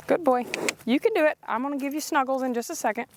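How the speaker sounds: high, encouraging voice to a horse; coaxing pet talk. Real, not acted. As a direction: coax him sweetly over the fence. Coaxing horse over fence.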